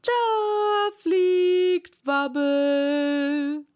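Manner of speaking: long drawn-out, high, loud call; chorus shout. Acted. The animals' chorus seeing Wabbel fly, drawn-out shout. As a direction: stretch every word out like a cheering crowd. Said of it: "einen allgemeinen Chor".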